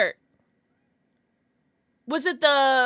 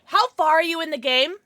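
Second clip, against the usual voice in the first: usual speaking voice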